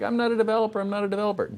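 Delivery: high, whiny mock protest; mock freak-out. Presenter imitates attendees scared of objects, mock panicked protest. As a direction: plead it in exaggerated panic. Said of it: "don't freak out when you hear".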